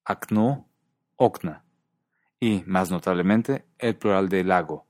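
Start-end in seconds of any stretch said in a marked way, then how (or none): 0.1-1.6 Okno stressed on the end, okna stressed on the first syllable, OKna; careful demo of okNO against OKna; stress shift. Shown as a demonstration. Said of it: "Volvamos a escuchar".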